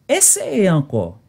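ordinary talk, nothing marked

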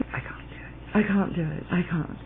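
hushed, fading admission of defeat; giving up. Pretend. Abandoning the snake story, softly defeated. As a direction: murmur it softly as you give up.

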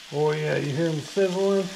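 usual speaking voice

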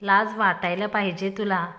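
ordinary delivery

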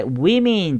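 women as "wimin", both vowels an i sound; careful pronunciation of women. Shown as a demonstration. Teacher warning about pronunciation, women said as "wimin". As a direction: say women slowly with i in each syllable. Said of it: "これちょっと発音注意ね".

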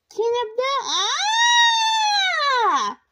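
long high loud scream cutting off the boast; startled cry. A narrator's reading. Bradley wiping out mid-boast, screaming. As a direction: yell as you fly off the board.

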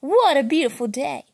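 loud bold chant. Acted. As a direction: proclaim it, slowing down at the end. Refrain called out loud.

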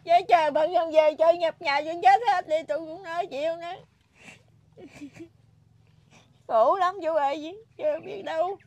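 high, loud sobbing lament; real crying. Real, not acted. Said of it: "khóc luôn kìa quý vị".

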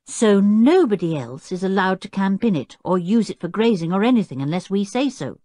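a mild note of triumph; triumphant. A narrator's reading. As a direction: crow over being right, lightly.

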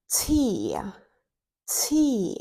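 whispered on the exhale; breathy exhale. Shown as a demonstration. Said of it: "Теж на видиху".

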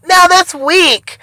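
high, drawn-out mock yell; imitated scream. Imagined viewers screaming at the weak Machamp, mock yell. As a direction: yell it slowly like a heckling viewer. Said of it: "everybody's probably screaming out".

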